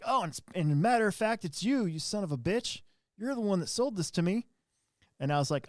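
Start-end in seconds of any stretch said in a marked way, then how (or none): 0.0-4.5 agitated customer. Retold or imitated. Customer confronting the store manager, agitated and higher. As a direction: voice the irate customer accusing you. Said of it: "is very agitated".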